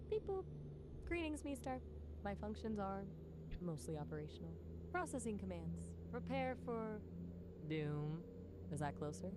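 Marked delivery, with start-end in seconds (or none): 0.1-8.2 higher, frantic little robot voice, doom at the end; squeaky hyper robot pushing toward doom; robot like Gur. Pretend. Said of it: "Let's try that".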